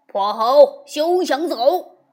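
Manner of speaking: loud, slow roaring threat; furious shout. A narrator's reading. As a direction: bellow at the monkey. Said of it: "大骂".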